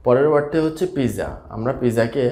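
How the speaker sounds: usual speaking voice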